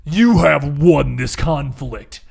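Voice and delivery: a booming unseen voice declaring victory; mysterious voice. Acted. GM voicing an unseen being to the players, slow solemn decree. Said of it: "you hear the voice".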